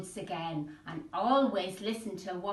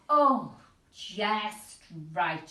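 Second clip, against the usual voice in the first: slow satisfied; 4 dB louder, much slower than usual. Acted. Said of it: "she said".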